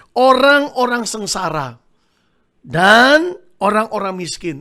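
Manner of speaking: slow, loud, drawn-out verse reading; stretched words, projected to the congregation; emphatic scripture reading. Real, not acted. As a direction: proclaim each phrase slowly and forcefully. Reading a Bible verse aloud to congregation.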